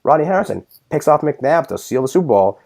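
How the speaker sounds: ordinary talk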